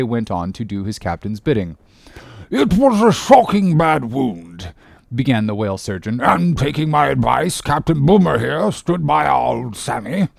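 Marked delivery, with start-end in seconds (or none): 2.5-4.7 slow, high-pitched surgeon voice; pompous doctor drawing out his words; ship surgeon. Acted. Surgeon beginning his account of the wound, slow and pompous. 6.2-10.3 higher-pitched pompous surgeon; put-on voice of a self-important doctor; ship surgeon. Surgeon recounting his advice to the captain, pompous.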